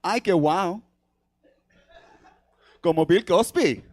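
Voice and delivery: imitating an excited Puerto Rican mother; lightly voiced delighted mamá in Spanish; excited mom. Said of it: "Super excitedly, she goes".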